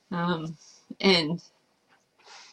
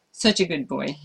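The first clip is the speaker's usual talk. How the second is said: ordinary delivery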